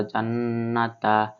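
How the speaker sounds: জান্নাতা with the double ন held in the nose; nasalized ghunnah. Shown as a demonstration. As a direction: hum the ন্না of জান্নাতা. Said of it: "গুণনা করে পড়তে হবে". (জান্নাতা-র ন্না গুন্নাহ করে টেনে পড়া)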